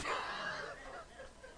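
breathless high laugh; high squealing laugh. Real, not acted. Preacher cracking up at his own story, squealing laugh. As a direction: let a squeaky laugh burst out.

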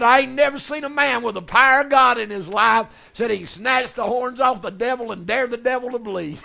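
loud, high hollering quote; shouted praise. Retold or imitated. Preacher hollering the man's praise to the congregation, loud.

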